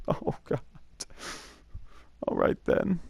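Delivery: whispered "oh God", quiet and resigned; soft whispered resignation; dismayed whisper. Retold or imitated. Reacting to the dead mouse.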